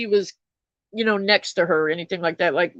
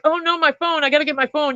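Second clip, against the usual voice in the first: put-on higher, frantic quote of the girl; panicked drunk girl; 6 semitones higher than usual. Retelling the drunk driver's cry, mildly panicked and higher. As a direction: mimic her panic over the phone. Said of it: "And she's like".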